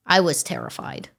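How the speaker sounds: normal talking voice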